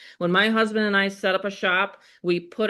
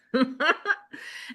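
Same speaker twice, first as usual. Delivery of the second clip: a soft giggle rising in pitch; 5 semitones higher, 4 dB quieter than usual. Real, not acted. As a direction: giggle as she hands over to Rob. Laughing before teasing Rob.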